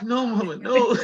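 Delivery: high, loud mock protest; laughing retold plea, pitched high; pleading son. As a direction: imitate a son protesting to his mama, voice way up. Retold son protesting to his mother.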